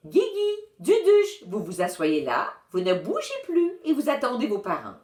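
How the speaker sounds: a touch of stern authority; authoritative. A narrator's reading. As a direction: lay down the law to the twins. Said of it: "fait preuve d'autorité".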